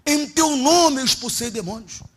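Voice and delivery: slow, high pleading; a believer pleading before the Lord. Retold or imitated. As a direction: plead your works to the Lord. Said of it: "Aí ele vai falar assim".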